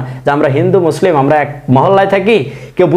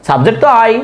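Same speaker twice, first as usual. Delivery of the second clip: ordinary delivery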